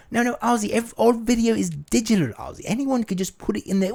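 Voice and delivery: high, patient Sharon correcting; high Sharon explaining; Sharon impression. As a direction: talk him down like Sharon. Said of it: "play all three parts".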